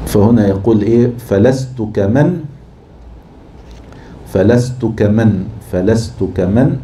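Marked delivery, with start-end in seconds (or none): 1.2-6.8 فلست كمن said slowly, syllable by syllable to the beat; metre scanning. Shown as a demonstration. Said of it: "مفاعلة".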